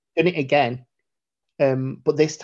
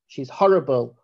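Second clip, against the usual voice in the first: usual speaking voice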